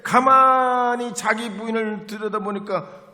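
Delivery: loud, high fiery preaching; preaching at full force. Acted. Preacher telling the congregation of the wild dawn prayer, fiery preaching. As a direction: pour it out like a revival preacher.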